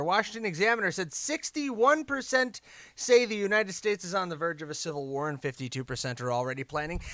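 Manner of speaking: ordinary talk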